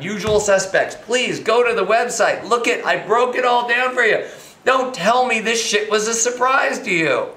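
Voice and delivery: ordinary delivery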